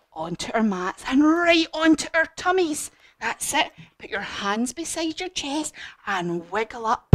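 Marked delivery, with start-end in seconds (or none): none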